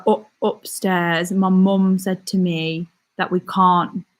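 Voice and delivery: slow, thick West Midlands home accent. Shown as a demonstration. Showing viewers her old home accent, thick West Midlands. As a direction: demonstrate a broad West Midlands accent, dragged out. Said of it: "I can do that accent".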